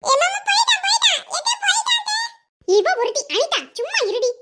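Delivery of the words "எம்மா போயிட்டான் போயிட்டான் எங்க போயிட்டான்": put-on gleeful kid announcing it; excited child. Child excited about baby pooping. (குழந்தை போயிட்டான் என்று உற்சாகமாகக் கூவும் சிறுமி)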